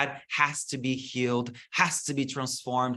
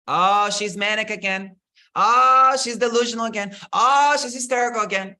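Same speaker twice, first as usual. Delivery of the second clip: higher, louder sneering judgment; mocking gossip; 7 semitones higher, 7 dB louder than usual. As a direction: mimic smug people dismissing her. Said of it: "What do we call that on, on TikTok?".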